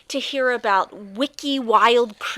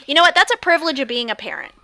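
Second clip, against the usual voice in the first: gloating, fast; 3 semitones higher, 6 dB louder, much faster than usual. Pretend. Gloating over eaten candy.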